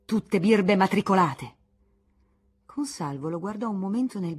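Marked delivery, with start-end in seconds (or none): 0.0-1.5 loud, slow, contemptuous; angry shout. Acted. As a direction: spit out each word. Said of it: "gridò improvvisamente".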